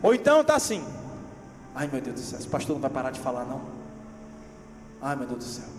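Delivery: ordinary talk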